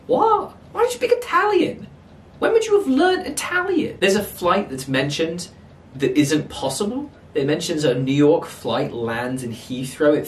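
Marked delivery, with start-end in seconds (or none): 0.1-4.0 very high-pitched baffled questions; real exasperation, voice shooting up; incredulous questioning. Real, not acted. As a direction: squeal your disbelief at the plot. Ranting at an absurd plot point.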